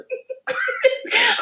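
high-pitched squeal of laughter. Real, not acted. After failed word.

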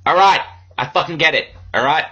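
loud, high, fed-up snapping; hammed-up exasperation; mock angry outburst. Guest snapping at the host, mock angry. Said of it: "not angry".

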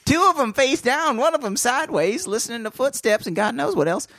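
high, laughing squeaky riff; squeaky joke voice. Pretend. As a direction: keep the silly squeak going.

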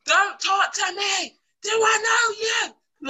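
high, drawn-out scream of outrage; shouting. Shown as a demonstration. Woman warding off a man at night, shouting. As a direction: shriek it slowly, scaring a stranger off. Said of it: "I will shout and scream".

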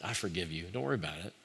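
soft, quiet words of pardon; a hushed, kind release from the debt; gentle forgiveness. Retold or imitated. The forgiver comforting someone who came open-handed, speaking softly. Forgiving friend voiced warmly.

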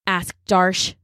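ordinary delivery

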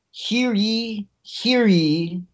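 slow proclamation, hear ye; mock royal decree. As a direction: town crier calling out. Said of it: "we need an encore of my performance".

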